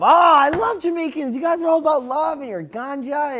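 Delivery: high, loud tourist gush; hammed-up adoration, imitating a clueless fan; mock gushing. Imitating a tourist who adores Jamaicans, gushing over the top.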